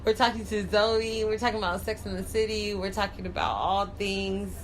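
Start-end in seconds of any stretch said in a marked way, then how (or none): 0.0-1.2 louder host read for the listeners; radio host intro. Acted. Host welcoming listeners back to After Hours, radio voice. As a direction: announce the show to the audience.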